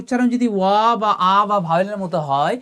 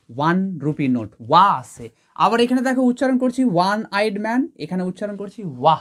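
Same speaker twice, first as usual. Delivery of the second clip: ordinary delivery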